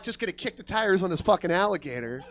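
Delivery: usual speaking voice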